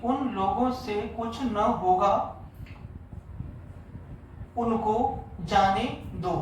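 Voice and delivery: very slow, word by word dictation. Shown as a demonstration. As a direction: dictate slowly, pausing after each phrase. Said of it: "sorry". (बहुत धीमा श्रुतलेख)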